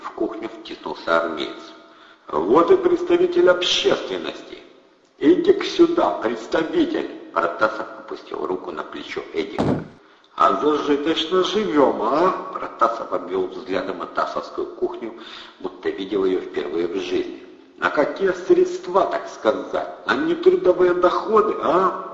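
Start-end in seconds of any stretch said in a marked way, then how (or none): 2.3-7.1 high, loud teasing gangster; loud mocking thug. A narrator's reading. Thug calls Eddie over, loud and mocking. 10.4-22.0 mock interrogation. A narrator's reading. Thug mock-questions the host about his income.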